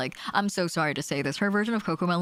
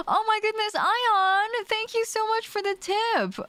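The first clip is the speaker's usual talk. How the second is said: high, bright burst of thanks, slower on the thanks; delighted thanks; slower than usual. Real, not acted. Streamer thanking Ion for the tip, high excited gush. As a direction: gush over the surprise.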